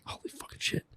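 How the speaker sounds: whispered in mock amazement; hushed, stunned whisper of disbelief; awed whisper. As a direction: breathe it out like you can't believe it. Acted reaction to psychic sketch.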